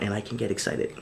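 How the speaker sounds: said softly and low, holding back; a quiet, unconvinced version before the real one; muted first try at sounding excited; low-key take. Shown as a demonstration. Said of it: "You just watch".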